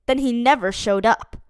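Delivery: plain reading voice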